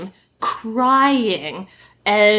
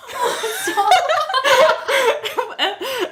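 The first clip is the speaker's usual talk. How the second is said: long high laugh. Real, not acted. Laughing at own story.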